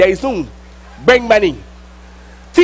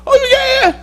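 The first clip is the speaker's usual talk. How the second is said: much higher, teasing put-on voice; surprised inmate; 14 semitones higher than usual. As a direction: pitch it way up like a startled old friend. Inmates greeting him in jail.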